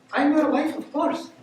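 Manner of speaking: high-pitched imitation of a wife; wife's voice. Bride granting her husband's request, in a high put-on voice.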